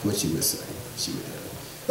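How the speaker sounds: breathy whispered aside to the audience. Real, not acted. Comic sharing the sister's line in a whisper. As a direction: drop to a whisper, confiding. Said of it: "whispered aside".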